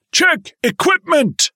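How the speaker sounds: high, slow shouted command; drawn-out bark over engine noise; jumpmaster barking cues. A narrator's reading.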